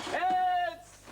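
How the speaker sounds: sudden loud, high yell; shouted warning. Acted. Calling out a warning.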